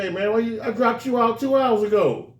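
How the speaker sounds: dad asking why his son is at school so late; confronting dad. Retold or imitated. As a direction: demand an answer from the son.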